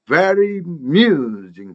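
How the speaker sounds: slow "very amusing", the a swallowed after very; absorbed vowel. Shown as a demonstration. Teacher demonstrates the absorbed vowel, "very amusing" joined up. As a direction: glide from "very" straight into "amusing". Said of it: "모음 뒤에 흡수가 돼서".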